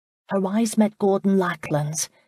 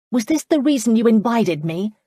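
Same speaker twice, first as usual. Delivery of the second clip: louder, higher indignant demand; 5 semitones higher, 5 dB louder than usual. A narrator's reading. Charlotte confronts the laird about his motives, indignant and loud. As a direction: confront him, pitched up and pushing. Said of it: "she demanded".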